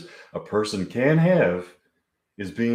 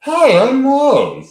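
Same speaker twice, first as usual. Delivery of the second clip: much higher, slow, cheerful big-cheeked waitress voice; Maud's voice; 13 dB louder, slower than usual. Acted. Waitress Maud greets Mike and Winona, high cheerful put-on voice. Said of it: "Okay, this is Maud's voice".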